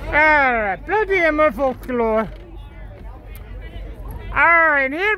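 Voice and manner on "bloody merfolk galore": normal talking voice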